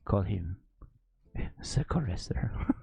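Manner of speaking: low mock Russian accent; comic Russian accent breaking into a laugh. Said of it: "That's my Russian accent".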